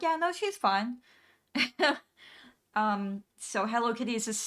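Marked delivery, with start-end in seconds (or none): 0.0-1.0 higher, breezy dismissal; big sister waving it away; careless brush-off. Retold or imitated. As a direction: toss it off without a care. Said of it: "and she's like".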